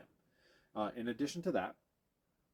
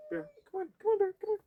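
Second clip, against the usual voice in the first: high, coaxing call to the dog; 5 dB louder, much faster than usual. Real, not acted. Trainer calling Bear to follow, high and coaxing. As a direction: coax Bear along in a high voice.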